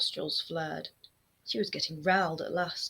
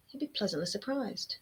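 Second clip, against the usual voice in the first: usual speaking voice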